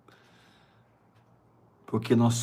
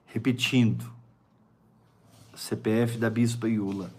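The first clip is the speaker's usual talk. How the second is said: ordinary talk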